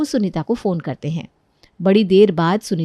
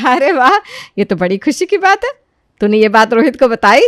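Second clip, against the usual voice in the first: loud, raised, pleased exclamation; delighted mother-in-law; 3 semitones higher, 6 dB louder than usual. Acted. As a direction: rejoice at the good news. Mother-in-law delighted at good news. (खुश होकर ज़ोर से)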